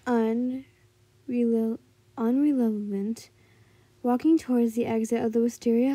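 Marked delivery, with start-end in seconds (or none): none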